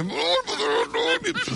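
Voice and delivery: very high, slow falsetto plea; hammed-up official begging him to keep his pants on; mock pleading official. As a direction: beg in a squeaky falsetto. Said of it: "le decía".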